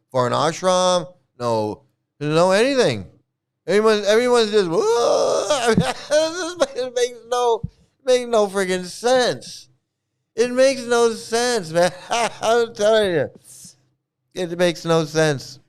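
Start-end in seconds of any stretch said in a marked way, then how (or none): 4.7-5.7 high imitated crying howl; mock wailing. Mocking everyone crying all day, high fake wail. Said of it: "laughing and crying all day". 5.8-13.3 higher, slow, laughing protest; laughing exasperation. Real, not acted. Telling listeners it makes no sense, laughing in exasperation.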